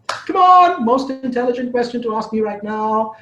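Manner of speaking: high, drawn-out urging come on; coaxing call. Acted. Urging the silent audience to speak, high coaxing call. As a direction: egg the audience on to answer.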